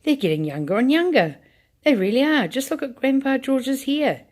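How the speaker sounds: put-on old man yelling in amazement; excited yelling. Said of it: "Grandpa Joe was yelling".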